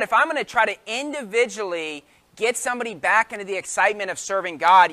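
normal talking voice

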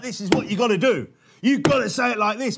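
mock adviser laying down the law; insistent soundbite advice. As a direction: hammer it out like a slogan.

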